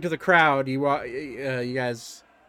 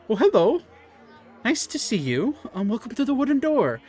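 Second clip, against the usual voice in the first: higher, welcoming hostess greeting; friendly barkeep voice; 7 semitones higher than usual. Acted. Elephant barkeep welcoming the party, higher friendly voice. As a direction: greet the newcomers sweetly. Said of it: "she's like".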